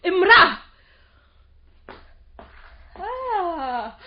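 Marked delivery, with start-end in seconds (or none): 0.0-0.6 loud heated row; angry shouting match. Acted. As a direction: shout each other down. Heated quarrel about woman. (شجار غاضب بصوت عالٍ)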